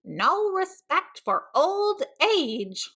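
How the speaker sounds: slow, high, mock-offended old man; grumbling grandpa. Archie as grandpa grumbles at the licking dog, slow. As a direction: complain like a fussy old-timer.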